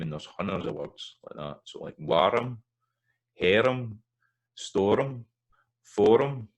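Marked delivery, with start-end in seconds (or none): 1.6-6.5 warm sounded as "war-um", higher and louder; Scots epenthetic vowel. Shown as a demonstration. Teacher demonstrating Scots R-M words for singers. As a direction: give warm, harem, storm, forum two beats each. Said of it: "it really comes out when an R goes on to an M".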